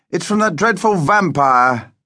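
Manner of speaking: high, slow, drawn-out call; calling upstairs. A narrator's reading. Father announces the vampire's letter, calling upstairs. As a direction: stretch it out as if calling up the stairs. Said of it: "her father shouted up the stairs".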